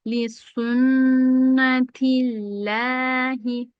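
slow drawn-out chanted recitation; Quran recitation. Shown as a demonstration. Teacher reciting the verse for students. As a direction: chant each vowel long and even. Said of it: "teacher recites Quran verse".

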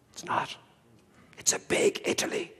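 a conspiratorial aside; whispered punchline. Real, not acted. Speaker letting the audience in on a joke about France, whispered.

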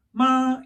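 "마" high and level; first tone. Shown as a demonstration. Teacher reviewing the tones, "마" high level first tone. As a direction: hold "마" on one high pitch. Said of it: "일 성이었고".

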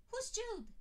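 high, loud impatient question; Ginny impatient to get moving, high and loud. A narrator's reading. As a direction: snap the question out. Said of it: "asked in patience".

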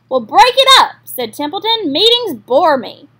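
rude dismissal. Acted. Rat brushing off meeting.

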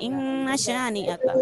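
इन्ना with ghunna, short na; इन्ना with nasal hold on the doubled n and a short, unstretched na; intent: demo of inna without stretching. Shown as a demonstration. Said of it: "तो हमने यहाँ पढ़ना है इन्ना".

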